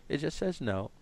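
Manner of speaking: usual speaking voice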